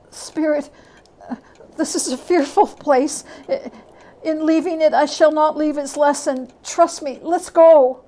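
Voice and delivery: put-on a terrified old man begging to leave; frightened Scrooge.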